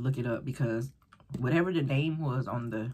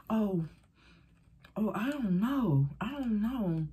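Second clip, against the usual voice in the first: slightly higher, slow unsure answer; confused fumbling; 7 semitones higher, slower than usual. Retold or imitated. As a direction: stammer it, lost.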